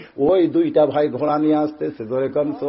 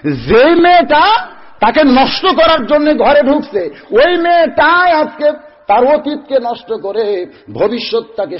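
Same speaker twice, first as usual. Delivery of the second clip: high, loud, drawn-out sermon delivery; full-force preaching; 9 semitones higher, 7 dB louder than usual. Real, not acted. Preacher tells the crowd of a beautiful girl, full-force preaching. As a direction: preach it out loud with stretched words.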